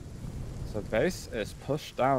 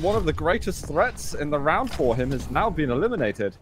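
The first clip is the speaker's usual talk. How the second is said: much higher, louder hype call; excited play-by-play; 9 semitones higher, 4 dB louder than usual. Real, not acted. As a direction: ride the rising action of the fight. Hyped call of a kill.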